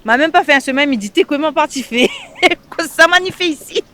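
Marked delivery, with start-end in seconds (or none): 1.1-3.9 retold exclamation breaking into laughter; higher voice, laughing through the retold question; laughing self-quote. Laughing as she quotes her homesick first week, amused self-mockery.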